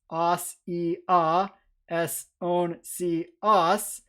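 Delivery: very slow "sasia esoncias", each syllable separate; broken into syllables. Shown as a demonstration. Lecturer fills in endings from the mnemonic, "sasia esoncias" syllable by syllable. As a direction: draw out "sasia esoncias" piece by piece with gaps between syllables.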